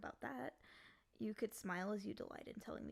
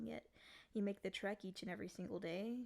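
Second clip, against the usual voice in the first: ordinary talk, nothing marked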